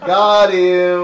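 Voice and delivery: loud, gleeful "Got him!"; triumphant gotcha. Real, not acted. Scoring a point on a friend, triumphant. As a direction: crow over the win.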